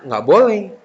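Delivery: ordinary delivery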